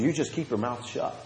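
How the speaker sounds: soft, controlled mock warning; stern order. Retelling a preacher silencing his visitor, quietly stern. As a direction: lay down the rule quietly. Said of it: "That's exactly how he said to me".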